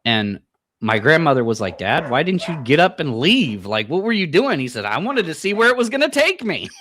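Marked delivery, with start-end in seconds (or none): 1.8-4.6 imitating a loud, higher-pitched exasperated question; exasperated grandmother. Grandmother asking her dad why he stayed, exasperated. 4.8-6.7 much higher, loud punchline voice; retold grandpa's answer. Grandpa explaining why he stayed in bed, high and emphatic.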